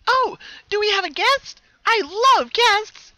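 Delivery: very high, loud, drawn-out delight; an eerily cheerful hidden voice; gleeful mystery pony. Acted. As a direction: sing it out like a thrilled party pony. Mysterious voice greets guest.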